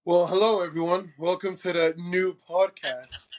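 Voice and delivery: normal talking voice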